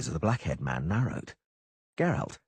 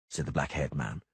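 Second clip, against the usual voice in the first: ordinary delivery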